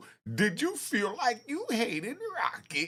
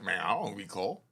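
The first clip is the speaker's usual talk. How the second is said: mock witness; 13 semitones lower, 4 dB quieter than usual. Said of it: "That's all he had to say".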